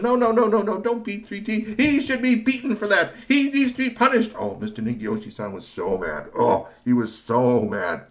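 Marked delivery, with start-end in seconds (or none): 0.0-4.3 loud high protest. Acted. Professor protests loudly.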